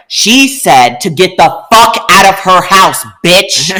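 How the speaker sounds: loud, slow yell in her face; retold yell. To the girlfriend in her face, a loud drawn-out yell. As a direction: shout her off the property, drawn out. Confronting woman at the door.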